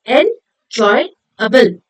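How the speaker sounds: very slow 'enjoyable', broken into en-joy-able; syllable by syllable. Shown as a demonstration. Teacher showing what it is made up of, syllable by syllable. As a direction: say 'enjoyable' in three separate beats. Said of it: "made up of three syllables".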